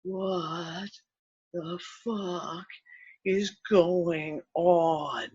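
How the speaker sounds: hushed, very slow disbelief; bewildered. Retold or imitated. Tourist standing unsteady in the gorge video, hushed bewilderment. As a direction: draw out each word softly, retold. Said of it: "standing like this going".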